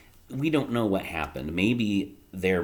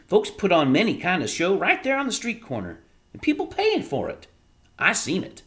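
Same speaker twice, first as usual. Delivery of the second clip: put-on high, loud eager boy voice; excited boy; 10 semitones higher, 7 dB louder than usual. A narrator's reading. Bryce telling Edward his street show plan, eager and high. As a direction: pitch the money plan with boyish excitement.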